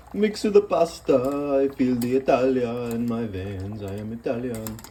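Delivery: slow, lightly put-on Italian accent for the stirring; mock Italian accent. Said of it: "I'm probably not even doing the accent right".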